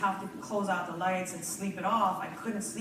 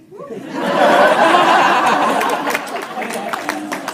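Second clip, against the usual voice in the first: big laugh; 9 semitones higher, 16 dB louder than usual. Real, not acted. Laughs after slim skinny sexy.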